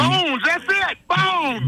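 high-pitched excited exclamation; excited recognition. Real, not acted. Caller recalling the host's old nickname, excitedly. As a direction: cry out the remembered name, pitched high.

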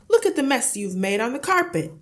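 irate husband, high and loud; angry shout. A narrator's reading. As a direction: shout in outrage at the spill. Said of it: "he shouted".

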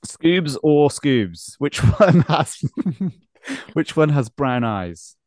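slow, drawn-out quizmaster question; slow quiz host. Acted. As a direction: stretch each word like a game show host.

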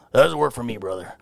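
lightly voiced Hulk Hogan, sinking low at the end; mild wrestler catchphrase trailing off low; Hulk Hogan impression. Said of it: "Hulk Hogan".

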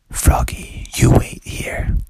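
hushed stage whisper. Acted. Dad tells Froggy to wait, whispering. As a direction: whisper a secret order, drawn out.

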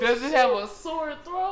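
slow, laughing question; laughing tease. Real, not acted. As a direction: ask it while cracking up. Teasing while laughing.

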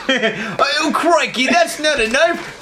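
put-on a lightly done Australian accent, laughing; Crocodile Dundee impression. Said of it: "Crocodile Dundee when he's like".

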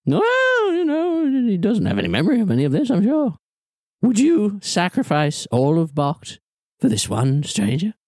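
high, airy and dismissive; the villain shrugs off the accusation; scheming NPC voice. Acted. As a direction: brush off the charge lightly. NPC answers the player.

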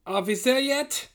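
put-on high, exasperated German-accented whine. A narrator's reading. Nazi leader nagging his guide on the march, exasperated. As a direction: ask it high, pitch climbing, fed up. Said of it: "asked the exasperated Herr Blau".

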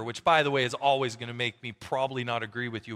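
ordinary talk

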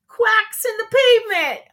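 high, loud punchline. Acted. Host reads the duck joke answer to listeners, high and loud. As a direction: land the pun with a big high finish.

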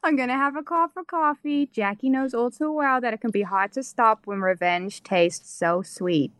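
put-on Boston accent starting high and settling down. Host retrying the Boston line for the guest, Boston accent. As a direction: commit to a broad Boston accent. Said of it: "let's hear the Boston".

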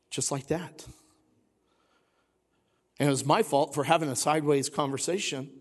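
normal talking voice

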